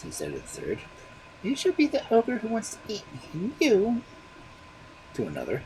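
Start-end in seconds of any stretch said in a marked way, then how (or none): 1.4-4.0 put-on very high baby voice; a child naming his bones. A narrator's reading. Baby naming the magic bones, very high.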